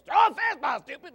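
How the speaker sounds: a high shout that falls away at the end; kid's copied yell. Retold or imitated. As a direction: holler it like the kid copying dad. Kid imitates the parent's yell.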